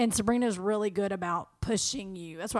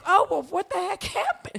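dazed cartoon victim; 11 semitones higher, 8 dB louder than usual. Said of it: "and you're like".

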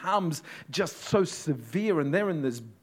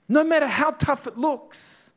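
strong promise; 13 semitones higher, 8 dB louder than usual. Acted. Jesus reassures the disciples.

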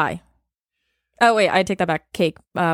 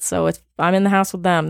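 ordinary talk

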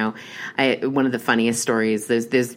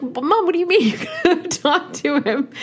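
high, loud disbelieving squeal; shocked at mom's plan, voice shooting up; squealing in disbelief at mom; incredulous; 3 dB louder than usual. Retold or imitated. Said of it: "And I'm like".

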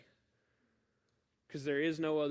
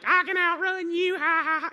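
put-on much higher, loud child voice with taunting laugh; cocky little kid; 11 dB louder than usual. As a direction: tease like a four-year-old running off. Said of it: "And I'm like".

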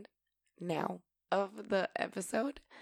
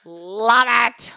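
sudden loud, higher burst; emphatic exclamation; 4 semitones higher, 15 dB louder than usual. Real, not acted. Host delighted with her own words, loud exclamation. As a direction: belt it out with relish.

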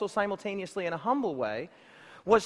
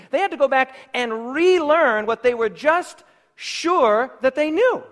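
louder and higher, building with each line; preaching crescendo; 5 semitones higher, 7 dB louder than usual. Acted. Preacher driving home his refrain to the congregation, building loud.

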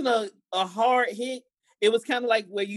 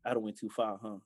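quiet realization; 12 semitones lower, 12 dB quieter than usual. Retold or imitated. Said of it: "I'd be like".

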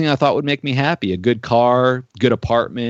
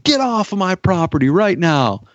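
imitating an angry pastor, pitched much higher; retold angry order; 7 semitones higher than usual. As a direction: order him off the property, mock-angry. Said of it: "he threw that gospel tract".

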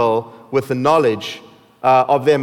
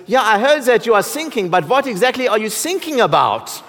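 put-on higher-pitched, comically thick German accent; puzzled German coast guard, pitched up; 6 semitones higher than usual. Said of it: "in a thick German accent".

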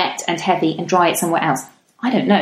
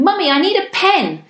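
put-on high little-boy voice wanting a pen; child asking mummy; 11 semitones higher than usual. Son bursting in to ask mummy for a pen, high child voice. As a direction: pitch up like an eager kid.

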